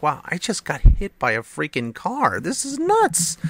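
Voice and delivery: retold stunned outburst, rising high; astonished. Dazed kid to himself after being hit, astonished. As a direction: jump up in pitch in disbelief.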